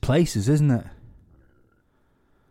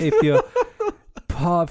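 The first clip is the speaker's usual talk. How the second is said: laughing; 6 semitones higher than usual. Real, not acted. Laughing mid-sentence.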